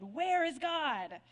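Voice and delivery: put-on preacher losing patience with the silent boy, bellowing; mild bellow. A narrator's reading. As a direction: lean in and boom the question. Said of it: "raised his voice even more and ... bellowed".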